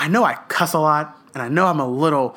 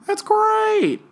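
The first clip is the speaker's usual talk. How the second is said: much higher, slow falsetto fake cheer; mock cheerful; much slower than usual. Fake-happy falsetto about muting yourself on the phone. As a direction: chirp it sweetly like a fake-happy friend.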